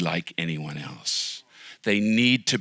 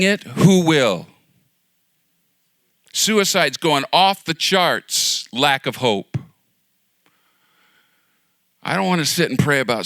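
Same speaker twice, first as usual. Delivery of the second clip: loud, higher, urgent preaching; full-force preaching; 6 semitones higher, 6 dB louder than usual. Acted. Pastor urging the congregation to bring hope, loud preaching. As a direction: drive the hope home to the crowd.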